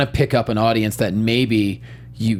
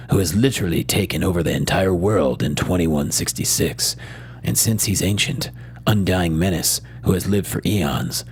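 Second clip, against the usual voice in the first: movie trailer voice; 7 semitones lower than usual. Shown as a demonstration. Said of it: "I'll do it in my movie trailer voice".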